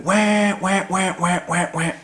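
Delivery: high mouth-made duck wah calls; duck comeback call. Shown as a demonstration. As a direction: call it like a duck coming back. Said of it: "like a comeback call".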